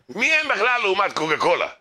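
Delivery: high-pitched mock outrage; scornful indignation. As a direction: dismiss the rivals as nobodies. Acts Coca-Cola side scorning the rivals.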